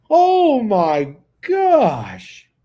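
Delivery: long, drawn-out loud amazement; astonished. Real, not acted. Bettor learns a hitter had three homers, astonished. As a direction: stretch each word in real disbelief.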